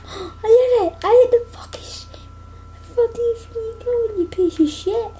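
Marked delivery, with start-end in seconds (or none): 0.1-2.2 gasping excitement. Real, not acted. Landing a hard hit.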